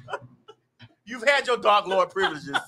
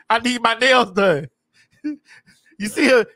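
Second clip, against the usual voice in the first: ordinary delivery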